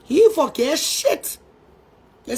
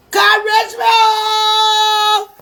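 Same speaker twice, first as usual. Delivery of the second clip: crying; 4 semitones higher, much slower than usual. Real, not acted. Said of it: "I feel like crying".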